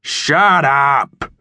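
bossy farmer bellowing shut up; irritable yell. Acted. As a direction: snap it at the noisy pigs. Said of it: "irritable voice yelled".

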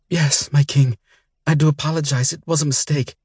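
high, trembling plea to the king; pleading woman. A narrator's reading. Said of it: "she replied".

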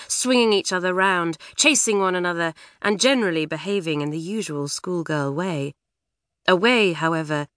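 plain reading voice

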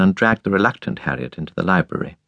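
ordinary delivery

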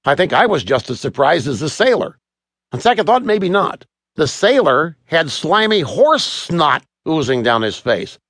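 ordinary delivery

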